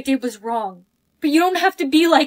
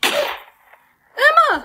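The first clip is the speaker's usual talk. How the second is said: shocked cry; 12 semitones higher than usual. Acted. Shocked shout at a friend.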